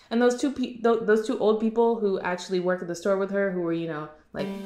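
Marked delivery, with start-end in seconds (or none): none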